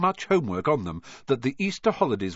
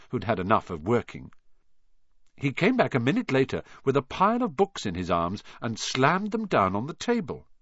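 ordinary delivery, nothing marked

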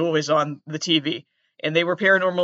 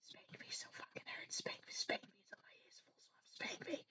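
hushed whisper, mocking a kinky ASMR video; 26 dB quieter than usual. Mocking an ASMR creep whispering at bedtime. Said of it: "somebody's just like".

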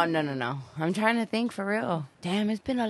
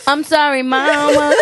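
louder and higher, apologising through laughter; sorry said mid-giggle, loud and high; laughing apology; 8 semitones higher, 10 dB louder than usual. Real, not acted. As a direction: beg mama's pardon while cracking up. Laughing apology to her mom.

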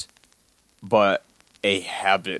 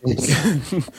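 playful squeal; 3 semitones higher, 4 dB louder, much slower than usual. Pretend. Joking big hug squeeze.

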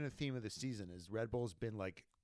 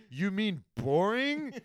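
slow, high sarcastic jab; 7 semitones higher, 6 dB louder, slower than usual. Retold or imitated. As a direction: drawl it with mock innocence. Sarcastic quote of herself.

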